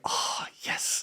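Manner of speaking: breathed-out, whispered delight; relieved whisper. Retold or imitated. Coach on learning team is safe, relieved whisper. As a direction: savour it in a whisper.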